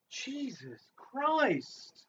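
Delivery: slow drawn-out exasperated groan; exasperated sigh. Acted. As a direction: let it drag out, exasperated. Exasperated exclamation.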